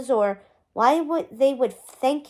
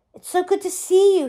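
higher, eager gush; 5 semitones higher, 3 dB louder than usual. A narrator's reading. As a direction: pour out the warmth, a little higher. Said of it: "he gushed".

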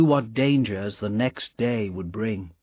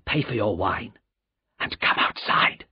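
low frightened voice; 3 semitones lower than usual. A narrator's reading. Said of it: "in a low, frightened voice".

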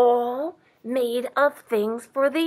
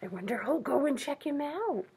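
soft, higher musing; hushed wondering; 4 semitones higher, 10 dB quieter than usual. Real, not acted. Reader wonders with children who will go, hushed. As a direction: wonder aloud quietly after a gasp.

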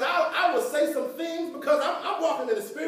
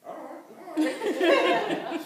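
drawn-out agreeing crowd voice; mock slow "all right"; compliant agreement; 3 semitones higher, much slower than usual. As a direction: play people slowly giving in. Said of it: "People like".

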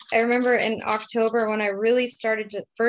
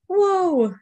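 amazed whoa; 10 semitones higher, 3 dB louder than usual. Retold or imitated. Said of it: "I was like".

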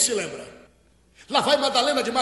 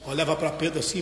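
normal talking voice

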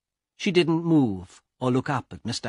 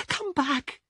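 high, broken crying plea; a woman weeping over her brother; sobbing plea. Acted. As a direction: beg through a sob. Elena begs dead brother.

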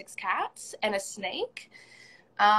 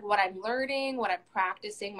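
ordinary talk, nothing marked

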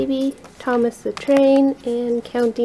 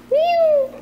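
cat meow. Pretend. Cat noise for toddler.

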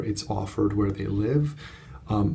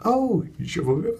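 higher, slow mock French; imitating French guest; 11 semitones higher, 3 dB louder, slower than usual. Imitating the Montreal guest speaking French, mock accent. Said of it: "speaking to me in French".

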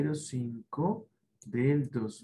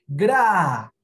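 gra said loud and crisp; loud model syllable; 4 semitones higher, 9 dB louder than usual. Shown as a demonstration. Teacher shows the class how gra sounds, loud. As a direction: belt out gra for the kids to copy. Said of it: "van a sonar de la siguiente manera".

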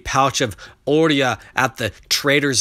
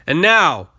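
raised voice calling out a sale; loud auctioneer call; 6 semitones higher, 6 dB louder than usual. A narrator's reading. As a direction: call out over the crowd. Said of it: "the auctioneer's voice rose above the bubbling crowd".

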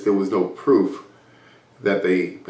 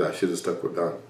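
much softer, defeated admission; dejected regret; 3 semitones lower, 10 dB quieter than usual. Retold or imitated. Partner after losing the case, a soft defeated regret. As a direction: mutter it with your head down. Partner put his head down.

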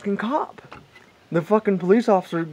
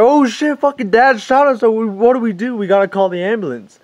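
high, loud freak-out, fading toward the end; blurted mock panic, raised pitch; panicked kids; 5 semitones higher, 3 dB louder than usual. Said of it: "they're freaking out".

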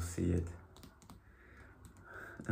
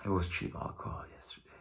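whispering; 7 dB quieter, faster than usual. Real, not acted. Tired aside about cheap alcohol.

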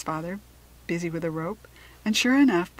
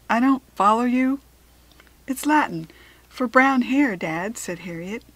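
louder, higher puzzled questions; bewildered dad catching up; father baffled by his daughter's Latin, loud and puzzled; 5 semitones higher, 8 dB louder than usual. A narrator's reading.

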